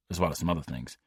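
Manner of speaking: plain reading voice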